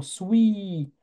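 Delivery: "swi" with the i held long. Shown as a demonstration. Teacher breaking the word down for students, prolonged vowel. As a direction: draw out the i at the end of "swi". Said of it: "j'entends un I long".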